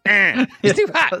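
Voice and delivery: high, whooping hot-tub impression; Eddie Murphy. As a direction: yelp it out in a high voice. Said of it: "That's Eddie Murphy in it".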